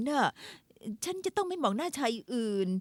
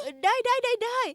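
flustered agreeing; 10 semitones higher, 4 dB louder than usual. Acted. Flustered agreeing after being scolded.

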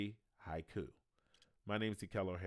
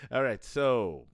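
put-on Irish accent; 9 dB louder than usual. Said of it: "I can only do that Irish accent".

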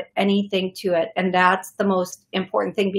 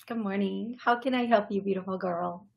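much softer, tender greeting; 8 dB quieter than usual. Real, not acted. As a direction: greet her gently. Greeting a caller softly.

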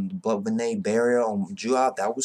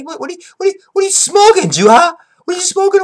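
high, loud mock outrage; mock outraged yell; 9 dB louder, faster than usual. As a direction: yell it up high like you can't believe it.

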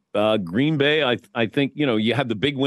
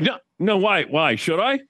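much higher, anxious squeaky replies; imitating Rich panicking; 7 semitones higher than usual. Rich answering Dan's taunt, high mock panic. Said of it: "he's like".

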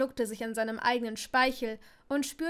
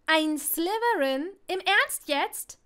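shocked disbelief; 5 semitones higher, 7 dB louder, slower than usual. Acted. Ginny fassungslos entsetzt.

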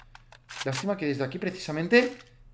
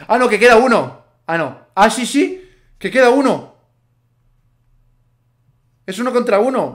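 tense excitement; 7 semitones higher, 12 dB louder than usual. Real, not acted. Realizes one enemy left.

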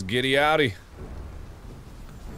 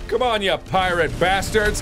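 higher, slow, hammed-up jeer; mock battle taunt; 7 semitones higher, slower than usual. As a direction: goad the pirates playfully. Taunting pirate enemies.

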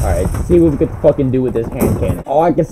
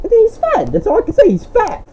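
much higher, mocking sing-song of he's fat; mocking falsetto. Real, not acted. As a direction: taunt him in a silly squeaky voice.

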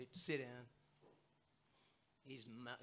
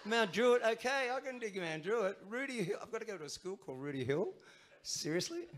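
outraged disbelief; 6 semitones higher than usual. Retold or imitated. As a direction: replay the teenage protest, half laughing. Retelling his outburst as a teen.

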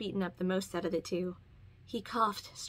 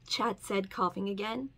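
ordinary delivery

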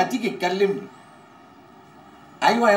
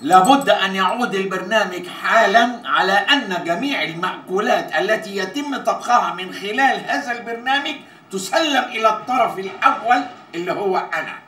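higher-pitched, declamatory legal dictation; formal contract reading; 3 semitones higher than usual. Acted. Man demanding all the show's food for himself, formal proclamation. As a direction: proclaim the whole clause without wavering.

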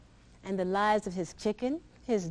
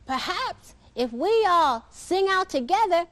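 higher eager duck voice; 8 semitones higher, 4 dB louder than usual. Acted. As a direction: suggest it like a bright duck. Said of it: "quacked the duck".

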